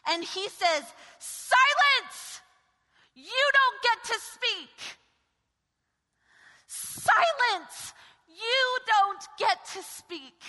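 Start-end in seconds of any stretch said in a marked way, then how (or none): 1.2-10.4 loud, slow, stern rebuke of the storm; commanding shout. Acted. Preacher voicing Jesus rebuking the storm, commanding shout. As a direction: bark the command down at the wind.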